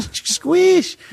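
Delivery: high, loud single word; shouting one word. Retold or imitated. One loud word to the deaf old lady. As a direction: bellow it to a deaf ear. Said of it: "And I was like".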